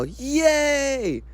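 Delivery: long high-pitched "yay"; mock cheer. Vlogger announcing to viewers that they got here, mock cheering. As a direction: draw out a put-on little cheer.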